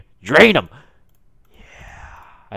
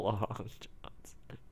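slow, softer whisper; hushed meme repeat; 2 semitones lower, 15 dB quieter, much slower than usual. Retold or imitated. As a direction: breathe it out slowly.

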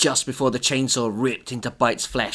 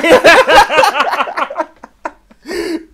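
loud high laughing; 10 dB louder than usual. Real, not acted. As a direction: shriek with laughter. Cracking up over own line.

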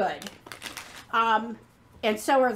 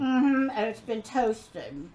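chewing while talking; slower than usual. Real, not acted. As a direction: mouth partly full of marshmallow. Seen 0: woman bites marshmallow just before, cheeks busy while speaking.

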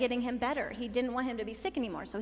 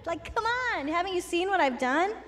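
incredulous; 7 semitones higher than usual. Acted. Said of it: "And Jesus was like".

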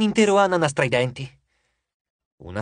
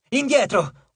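shouting; 6 semitones higher, much slower than usual. A narrator's reading. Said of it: "urlò Piper".

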